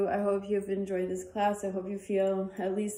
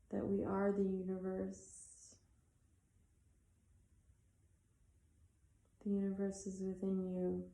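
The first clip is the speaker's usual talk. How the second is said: soft, unhurried meditation voice with long pauses; guided meditation; 5 dB quieter than usual. Acted. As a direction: ease them into stillness, letting each phrase settle.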